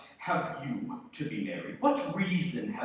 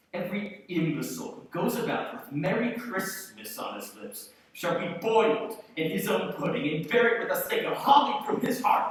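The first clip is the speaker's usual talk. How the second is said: rising from firm to furious; building rant; 3 semitones higher, 4 dB louder than usual. Acted. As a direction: build the tirade to a loud peak. Scrooge rants against Christmas.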